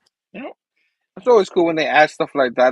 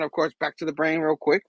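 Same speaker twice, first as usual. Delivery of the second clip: ordinary delivery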